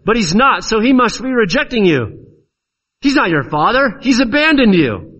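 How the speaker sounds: high-pitched, insistent jeering; mocking taunts. Onlookers taunting Jesus about his Father, mocking and accusing. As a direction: press the accusation, pitched well up. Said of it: "he's, he's mocked".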